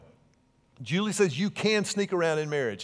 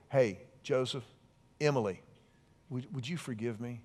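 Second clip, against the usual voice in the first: soft, lower plea; gentle apology to kids; 5 semitones lower, 8 dB quieter than usual. Pretend. Parent asking the children for forgiveness, softly. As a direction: ask them tenderly, almost hushed.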